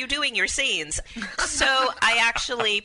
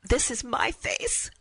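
slow, higher, contained declaration; reclaiming; 4 semitones higher, slower than usual. Retold or imitated. What goes through her mind while slapped, slow reclaiming. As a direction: state it slowly, claiming your own body.

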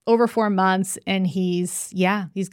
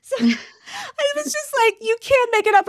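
very high, squeezed through laughter; helpless amusement. Real, not acted. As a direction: barely get it out for laughing. Overcome by her own comic story.

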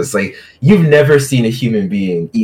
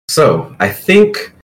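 talking through a mouthful, slow; mouth full; 6 semitones higher, much slower than usual. Acted. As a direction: say it while chewing. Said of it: "with a mouthful of food".

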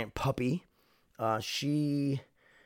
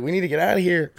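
a hint of panicked urgency, higher and louder; urgent mock exclamation; 6 semitones higher, 5 dB louder, faster than usual. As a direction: blurt it like you need to escape now. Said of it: "fucking was like".

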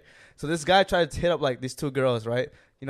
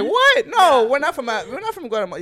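high, loud incredulous protest; mock outrage; 10 semitones higher, 7 dB louder than usual. Imitating offended people denying their supposed origin, mock outrage. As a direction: imitate indignant disbelief, voice shooting up.

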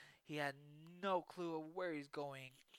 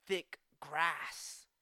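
ordinary delivery, nothing marked

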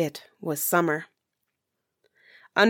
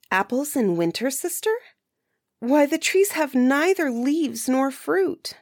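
incredulous; 8 semitones higher than usual. A narrator's reading. Girl protests impossible order.

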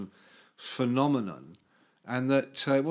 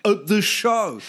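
loud, higher-pitched cry of astonishment; amazed exclamation; 5 semitones higher, 7 dB louder, slower than usual. Retold or imitated. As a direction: call it out like an astonishing sighting. Bandmates exclaiming at rare female sighting.

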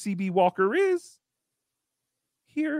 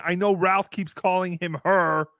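ordinary delivery

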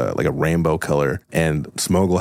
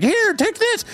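squeaky high voice. Acted. Clucky hands over shoes.

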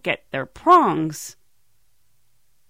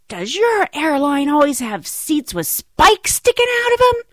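high, indignant outburst imitating a passenger; mad passenger; 8 semitones higher, 5 dB louder than usual. Angry passenger storms back to the galley to complain, mad. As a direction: storm in with a loud, disbelieving complaint. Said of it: "you can just tell he's mad. His face is red".

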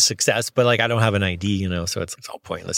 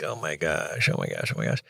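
low, soft muttered aside; retold worried mumble to himself; under his breath; 8 semitones lower, 8 dB quieter than usual. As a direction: mutter it privately, dropping way down in pitch. Retold panicked thoughts under breath.

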